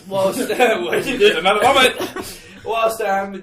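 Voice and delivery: laughing through words. Real, not acted. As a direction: breaks into laughter mid-sentence. Laughing mid-speech.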